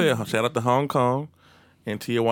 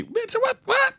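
much higher squeaky disbelief; mock outrage; slower than usual. Mocking a stripper's price, high shocked disbelief. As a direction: shriek it like the bill just came.

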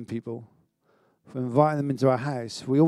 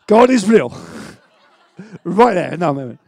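laughing with joy; 3 semitones higher, 11 dB louder than usual. Real, not acted. Joyful testimony, free chips.

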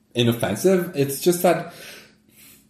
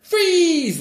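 even higher squeal of Freeze!; failed macho freeze. Retold or imitated. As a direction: squeal Freeze! far above your voice. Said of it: "channel your inner macho".

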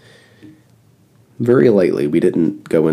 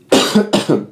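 cough; 8 semitones higher than usual. Real, not acted. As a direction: cough turned away from the mic. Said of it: "I'm coughing away from the mic".

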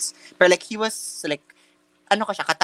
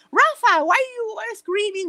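high-pitched, drawn-out teacher impression; imitated scolding teacher; 4 dB louder, slower than usual. English teacher demands why a student screamed, imitated high scolding. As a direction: play the teacher calling out a student, slowly and high. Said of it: "Sabi niya".

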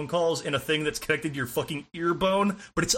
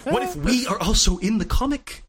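higher-pitched mock-profound revelation; Grant Morrison parody; 5 semitones higher than usual. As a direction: pitch it up like a mind-blowing comic twist. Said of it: "Every fucking Grant Morrison story".

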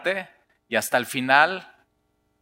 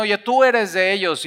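nail him with it; accusing bystander; 7 semitones higher than usual. Acted. Third person accusing Peter, higher and pointed.

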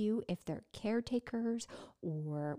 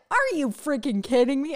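loud, raised mock outrage; hammed-up heckle, loud and high; incredulous guy friend; 4 semitones higher, 9 dB louder than usual. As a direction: imitate a buddy scoffing in disbelief. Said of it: "they're laughing hysterically".

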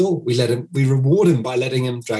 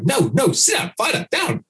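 high-pitched mock owner, pleading with an overexcited dog; fussing owner; 8 semitones higher than usual. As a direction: imitate a flustered owner begging the dog to settle, much higher. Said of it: "and you go".

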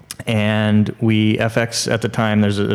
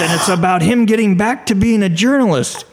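put-on old man's voice; 10 semitones higher than usual. Old producer musing to the executives, lightly voiced old man. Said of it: "the old producer goes".

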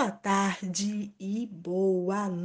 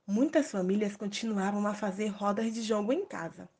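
ordinary delivery

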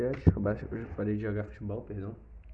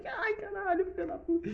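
very high squealing cry. Retold or imitated. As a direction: squeal it far higher, near falsetto. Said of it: "Eu falei".

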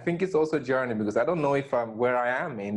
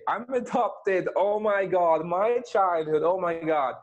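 mock oversharing; 7 semitones higher than usual. Guest mimics someone airing childhood woes for sympathy, mock-dramatic. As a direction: play up the poor-me confession, higher pitched.

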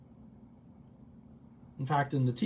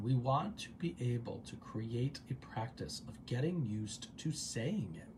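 softer, measured, drawn out at the end; careful, lower-key repeat; deliberate restatement; 3 semitones lower, 7 dB quieter than usual. Shown as a demonstration. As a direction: say it slowly and quietly to let it land. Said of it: "I'm gonna say that again".

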